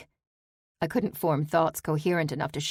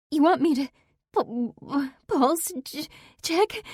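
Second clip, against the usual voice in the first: broken, much higher, choked question; stuttering sob; 11 semitones higher than usual. Acted. Daughter on the phone with dispatch, stuttering and sobbing. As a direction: stutter through tears. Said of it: "stutter and sob through her address".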